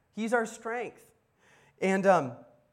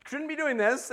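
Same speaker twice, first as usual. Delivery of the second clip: raised, nervous mock panic; alarmed realization; 4 semitones higher, 7 dB louder than usual. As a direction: Peter suddenly doubting his footing on water. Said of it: "starts realizing what he's doing".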